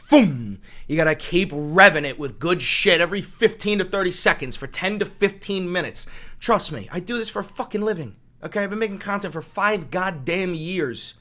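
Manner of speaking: loud exasperated rant; heated rant. Real, not acted. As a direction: hammer the point home to the chat. Ranting about content pacing.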